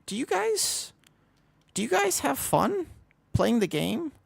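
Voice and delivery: high, falsetto-sweet mock innocence; mock sweet question. Streamer mocking toxic players with sweet high voice. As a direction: pipe it up high and syrupy-sweet. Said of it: "I actually just had to go like".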